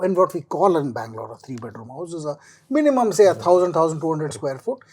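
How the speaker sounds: ordinary talk, nothing marked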